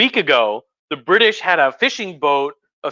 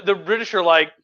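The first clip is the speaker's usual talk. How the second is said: usual speaking voice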